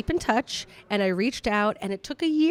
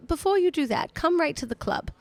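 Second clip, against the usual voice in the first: lightly mimicked British hosts, pitched up; imitated English hosts; 7 semitones higher than usual. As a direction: pitch it up as a helpful host instructing. Voicing the Liverpool hosts.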